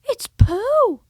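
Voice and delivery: high, loud delighted cry; a boy excitedly spotting his friend. Acted. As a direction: burst out with happy recognition. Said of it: "said Christopher Robin excitedly".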